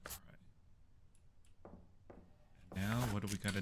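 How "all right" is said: hushed, unvoiced echo; whisper. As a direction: whisper it back to the screen. Whispering back to the game.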